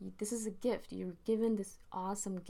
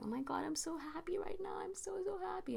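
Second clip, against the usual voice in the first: much higher, breathy and gushing; mock giddy gushing, way up high; gushing happy; 12 semitones higher, 4 dB quieter than usual. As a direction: gush about being happy, light and airy. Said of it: "I'll be like".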